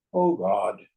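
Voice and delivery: a hint of a dying man's low groan; lightly voiced last words of a shot man; Ben Kingsley's dying gasp. Gandhi shot in the movie, mild low dying sigh. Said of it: "you hear Ben Kingsley's voice go".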